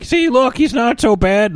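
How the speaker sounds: put-on high, loud, squeaky teen; Hiccup. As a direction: plead your case in a whiny kid voice. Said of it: "he's like".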